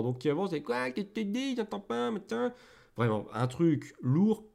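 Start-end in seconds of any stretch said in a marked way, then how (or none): 0.6-2.5 put-on high-pitched caricature of a raspy old-timer; hammed-up deaf old geezer, voice pitched way up; hard-of-hearing old person. Improv teacher mocking the clichéd old character, high raspy deaf-elder voice. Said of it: "avec une voix éraillée, et souvent en plus, malentendant".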